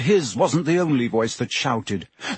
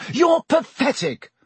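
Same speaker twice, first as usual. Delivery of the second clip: yelled; 13 semitones higher than usual. A narrator's reading. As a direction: hurl it at your teacher. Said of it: "Harry yelled".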